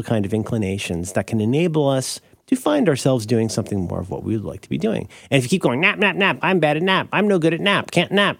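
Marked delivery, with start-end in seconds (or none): 5.8-8.4 higher, sing-song whine of nap, nap, nap; mock the anxious napper's fretting; whiny nap mantra. Mocking how a failing napper frets, mild high whine. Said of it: "that's the noise you make".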